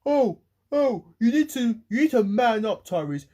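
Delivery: high, loud scolding imitation; mocking online commenters. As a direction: mimic a critic telling you off. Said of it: "go onto my Twitter and go saying".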